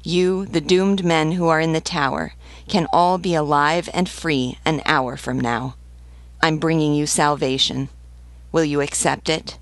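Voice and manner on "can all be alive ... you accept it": ordinary delivery, nothing marked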